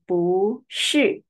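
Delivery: 不是 with 不 rising then 是 falling; bu shi demo. Shown as a demonstration. Teacher modelling 不是 with rising 不. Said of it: "We pronounce the second tone".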